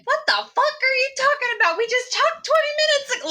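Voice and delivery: very high, baffled protest; hurried, high-pitched mock exasperation; incredulous face. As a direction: squeak it out in disbelief. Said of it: "like, with the face".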